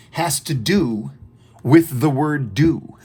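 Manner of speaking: has to do with the word do, stretched very slowly; slow down and lean on the word do; slow and stressed. Shown as a demonstration. Teacher stresses the key word for students. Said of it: "listen to this".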